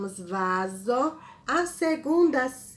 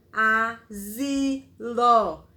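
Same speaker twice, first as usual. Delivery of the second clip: asilo slowly and louder, zi sounded clearly; slow and loud dictation; 5 dB louder, much slower than usual. Shown as a demonstration. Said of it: "Z, S, I".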